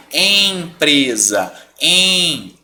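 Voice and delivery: EMPRESA stretched on a nasal first E; slow EMPRESA, EM through the nose; nasal vowel. Shown as a demonstration. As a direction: draw out EMPRESA, silent M. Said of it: "função da letra diacrítica na vogal".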